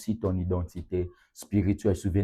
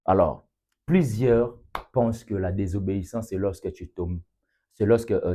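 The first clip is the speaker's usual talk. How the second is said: ordinary talk, nothing marked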